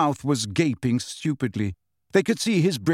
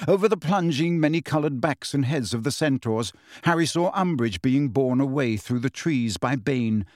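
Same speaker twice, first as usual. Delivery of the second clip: ordinary delivery, nothing marked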